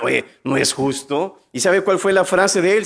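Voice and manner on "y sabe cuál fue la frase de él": normal talking voice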